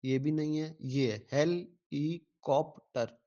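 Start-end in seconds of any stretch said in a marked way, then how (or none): 1.3-3.2 हेलीकॉप्टर as four slow beats, "hel / i / kop / ter"; correct syllable division. Shown as a demonstration. Teacher confirming the correct answer, हेलीकॉप्टर in slow separate syllables. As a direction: pause after each syllable of हेलीकॉप्टर. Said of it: "ये इसकी correct है".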